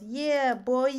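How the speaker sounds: high, loud mock "Yeah, boy"; imitating Jonathan. Streamer mimics friend Jonathan's catchphrase, loud hyped call. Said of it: "He goes".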